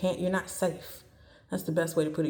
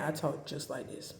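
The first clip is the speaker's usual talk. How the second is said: softer, level demonstration of being mad; controlled, understated anger; calm monotone; 3 semitones lower, 7 dB quieter than usual. Shown as a demonstration. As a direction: keep it low-volume and even. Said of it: "the same monotone, the same volume".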